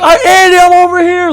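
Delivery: high-pitched mock holler; imitated call back. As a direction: call back as if from far away, hammed up. Imitates friend calling back to him.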